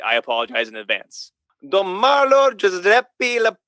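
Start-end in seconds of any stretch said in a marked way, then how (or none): 1.7-3.6 higher, slow, exaggerated French-style reading; mock French accent. Shown as a demonstration. Podcast priest attempts an Italian name for his co-host, mock French accent. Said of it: "try to read it like it's, like it's a French name".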